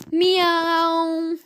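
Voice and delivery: high, long held meow; wailing cat meow. A narrator's reading. Cats leap onto floating furniture, wailing meow. Terrified cats afloat on sea.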